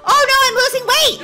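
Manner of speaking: thrilled high, loud shout; excited yell. Real, not acted. Gamer sees the weight dropping, excited yell.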